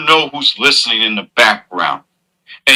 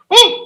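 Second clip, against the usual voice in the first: high mock woo. Mock celebration cheer.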